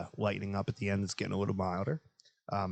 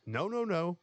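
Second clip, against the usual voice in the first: mock objection; 10 semitones higher, slower than usual. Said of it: "He's like".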